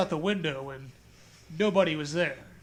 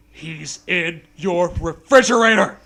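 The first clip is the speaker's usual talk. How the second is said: dramatic reveal; 9 dB louder than usual. Pretend.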